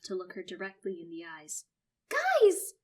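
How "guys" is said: very high, loud alarmed call; crying out. Acted. Sister crying out between them, loud. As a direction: cry out to stop them. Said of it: "Phoebe cries out".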